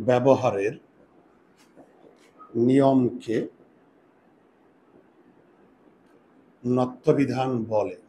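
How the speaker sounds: very slow, word by word, long pauses between; slow dictation. Shown as a demonstration. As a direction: dictate each word so students can write it down. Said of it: "এবার একটু সংজ্ঞা লিখো". (ধীরে ধীরে শ্রুতলিখন)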